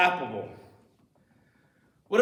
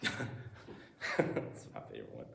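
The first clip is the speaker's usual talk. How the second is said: laughing aside; 14 dB quieter, slower than usual. Real, not acted.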